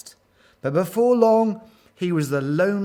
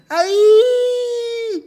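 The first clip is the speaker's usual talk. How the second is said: put-on high, piercing child's scream; long squealed cry of alarm; 9 dB louder than usual. A narrator's reading. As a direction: shriek like a frightened little one. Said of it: "screamed Abu".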